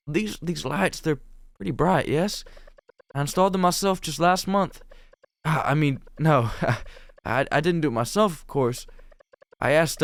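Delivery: nervous game heroine. Acted. Heroine covering her nerves with chat about lights, awkwardly. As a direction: stumble and backtrack self-consciously.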